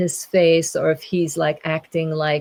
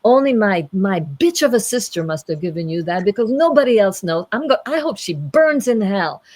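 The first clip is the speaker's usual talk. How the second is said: hammed-up furious outburst, raised pitch; really angry; 4 semitones higher, 4 dB louder than usual. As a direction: rant like an enraged storybook knight. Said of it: "the angry knight really gets angry".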